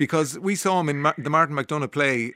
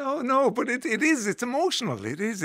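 soft, high, soothing voice; tender and hushed, pitched up; gently reassuring; 9 semitones higher, 5 dB quieter than usual. Real, not acted. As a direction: comfort someone who has just teared up. Host comforts tearful guest.

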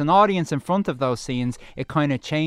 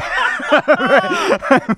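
burst of laughter; 10 dB louder than usual. Real, not acted. Embarrassed after the botched accent.

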